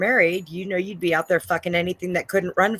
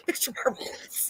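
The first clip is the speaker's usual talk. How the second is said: slow, hushed echo; whisper; 8 semitones higher, 9 dB quieter, slower than usual. Pretend. Echoing the co-host's call after a laugh, whispering. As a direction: whisper the name back slowly. Echoes co-host during whispering bit.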